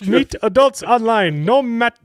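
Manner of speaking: put-on high, loud, slow Indian accent. Reading a dating ad to co-hosts, hammed-up Indian accent. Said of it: "I switched to an Indian accent".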